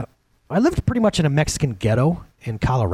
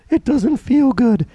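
mock whine; 8 semitones higher, 3 dB louder, slower than usual. Voicing a doubting believer.

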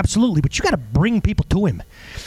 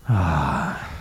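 a low sighing ah; 13 semitones lower, 3 dB quieter than usual. Shown as a demonstration. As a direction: breathe out a sorrowful ah. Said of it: "but he sighed, like".

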